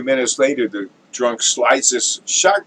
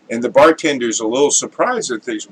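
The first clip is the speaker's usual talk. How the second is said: normal talking voice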